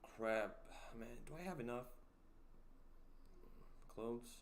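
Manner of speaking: usual speaking voice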